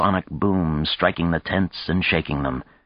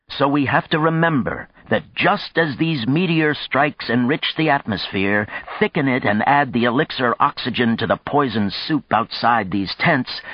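higher-pitched, earnest public oratory; impassioned speechmaker; 6 semitones higher than usual. A narrator's reading. Man warns fellow settlers of coming conflicts, impassioned oratory. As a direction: press the point home to the crowd.

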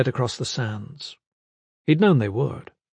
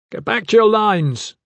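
shouted command; 9 semitones higher, 5 dB louder than usual. A narrator's reading. Gavin commands the fleeing nobles to re-form, shouting. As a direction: order the whole crowd back. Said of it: "Gavin shouted".

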